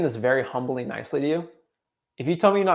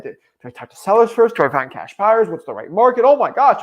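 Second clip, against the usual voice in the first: louder, higher frantic questions tumbling out; mock panic; 6 semitones higher, 9 dB louder than usual. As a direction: play a flustered newbie spiralling into panic. Panicking beginner unsure where to start.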